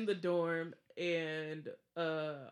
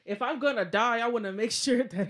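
excited; 4 semitones higher, 10 dB louder than usual. Real, not acted. Defending looking into hallway.

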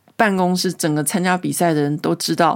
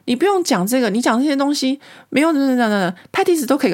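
higher, fast mock scolding; imitating a scolding; 7 semitones higher than usual. French wife scolding the woman director, fast mock scold. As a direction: rattle off the put-down, bossy and impatient. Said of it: "他就会骂他说".

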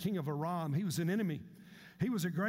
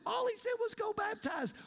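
much higher voice, incredulous; mock incredulous; 12 semitones higher than usual. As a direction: make the command sound absurdly simple. Servant mocks the simple command.

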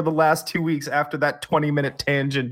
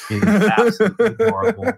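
laughing; 9 semitones higher, 4 dB louder than usual. Real, not acted. Teasing friend about crush.

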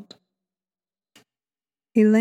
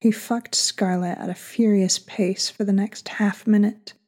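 plain reading voice

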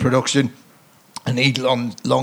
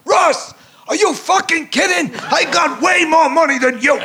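shouting across the restaurant; 13 semitones higher than usual. Retold or imitated. Yelling at Ross across the restaurant, high comic shout. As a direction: bellow across the room at Ross. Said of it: "he shouts across the whole restaurant".

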